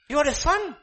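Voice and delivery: high, loud declaration to the church; emphatic cry. Real, not acted. Preacher exclaims to congregation.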